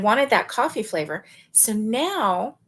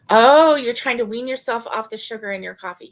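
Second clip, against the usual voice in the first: ordinary talk, nothing marked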